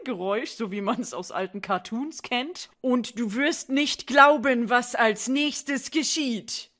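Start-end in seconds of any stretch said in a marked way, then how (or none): 2.8-6.7 slow, dramatic teaser voice; clickbait video narrator. Pretend. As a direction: tease it like a cheap cliffhanger.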